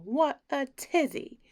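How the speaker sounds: ordinary delivery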